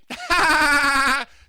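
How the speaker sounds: high, loud burst of laughter; loud shrieking laugh. Real, not acted. Telling a friend the subway story, shrieking laugh.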